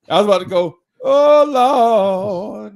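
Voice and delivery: loud, high, hammed-up preacher cry; mock preaching. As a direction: belt it out like a church preacher. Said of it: "I was about to start preaching".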